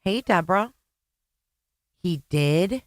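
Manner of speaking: ordinary talk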